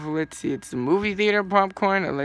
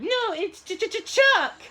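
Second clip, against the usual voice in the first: very high, slow stammered name; stuttering Chuck; 11 semitones higher, much slower than usual. Acted. Chuck insisting on his name, high slow stutter. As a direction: stutter the Ch like a train. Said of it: "you sound like Choo Choo Train".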